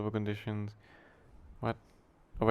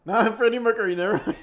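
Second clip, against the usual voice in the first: higher, louder words through laughter; laughing; 11 semitones higher, 9 dB louder, faster than usual. Real, not acted. Laughing at his own [name] joke, high and loud. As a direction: blurt it out while cracking up.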